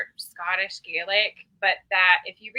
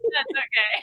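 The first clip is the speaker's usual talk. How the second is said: ordinary talk, nothing marked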